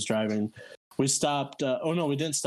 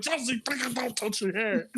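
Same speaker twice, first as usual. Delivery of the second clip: high-pitched mock exasperation; hammed-up frantic scolding; exasperated warning; 9 semitones higher than usual. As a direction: imitate a panicked telling-off, voice raised in pitch. Retold exasperated warning about puke.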